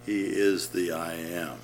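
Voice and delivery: ordinary talk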